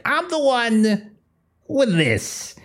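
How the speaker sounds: high, giggling menacing villain; creepy villain voice. Acted. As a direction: gloat like an unhinged captor.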